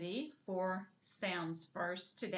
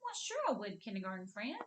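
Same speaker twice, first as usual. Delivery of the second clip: playful listener's reply; faster than usual. Acted. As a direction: play a willing grown-up answering a kindergartner. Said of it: "And they say".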